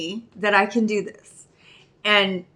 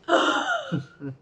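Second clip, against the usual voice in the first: shocked gasp; 4 dB quieter, much slower than usual. Retold or imitated. To her sponsor, a shocked gasped question. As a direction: gasp as if hearing a breakup. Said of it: "And I'm like".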